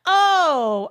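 loud, high, drawn-out "oh"; hammed-up dawning understanding; mock realization. Strangers hearing she's from L A, mock loud realization.

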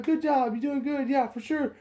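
very high mock encouragement; put-on, high-pitched agreement; playing along. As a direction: imitate someone cheerily agreeing without a clue. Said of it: "I'm just playing the fucking game".

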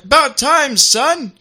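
high, loud hearty film father; Amos Diggory. Acted. Film father greeting his son, loud impersonation. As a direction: call out as the boy's hearty father. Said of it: "Oh, and Amos Diggory".

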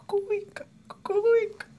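very high wailing loon cry; loon call. Retold or imitated. Man imitates a loon calling in the forest, high wail. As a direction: sing out like a loon across a lake.

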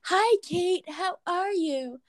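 mock a sugary teacher saying hello; high, syrupy mock greeting. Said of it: "He'd be like".

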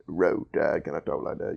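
very low, quiet Southern accent; low backwoods Southern voice; Southern accent demo. Shown as a demonstration. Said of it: "hear a little bit of that".

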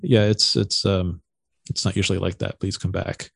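low, soft, halting apology; sheepish. Retold or imitated. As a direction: mimic an embarrassed host trailing off quietly. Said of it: "he very sheepishly goes".

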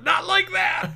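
high, shrill-sounding hammed-up yelp of pain; mock scream. Victim yells at the man drilling his leg, hammed-up scream.

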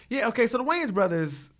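ordinary delivery

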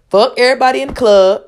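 Katt Williams impression. Comedian swearing at everybody in the club, in an impression voice. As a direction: belt it out high like a stand-up comic. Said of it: "in my best Cat Williams impression voice".

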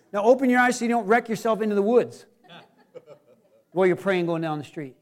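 usual speaking voice

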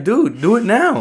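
urging. Retold or imitated. Answering people who wish they'd started younger, urging. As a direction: push them to act, pitch jumping up. Urging hesitant thirty-five-year-olds to act.

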